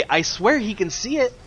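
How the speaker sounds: high-pitched, agitated protest; exasperated disbelief. Real, not acted. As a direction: argue in frustration at a player who won't act. Commentator baffled, protesting player's play.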